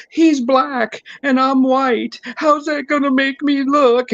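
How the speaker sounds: high, whimpering mock sob; hammed-up crying in a squeaky voice. Mocking the crying husband to the cops, high mock sob. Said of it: "then he starts crying".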